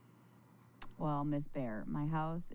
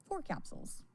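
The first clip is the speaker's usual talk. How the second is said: ordinary talk, nothing marked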